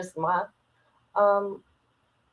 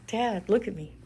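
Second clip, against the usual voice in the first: ordinary delivery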